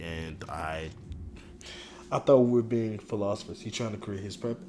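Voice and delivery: ordinary talk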